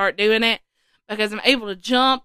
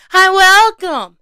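excited welcome; 8 semitones higher, 8 dB louder, slower than usual. Pretend. Enthusiastic welcome.

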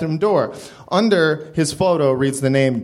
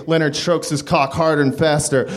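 usual speaking voice

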